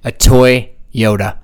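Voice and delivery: drawn-out, deliberate "A Toyota"; slow punchline. Acted. Dad to his podcast co-hosts, delivering the punchline slowly. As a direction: land the pun slowly, word by word.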